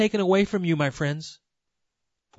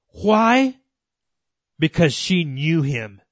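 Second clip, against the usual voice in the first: ordinary talk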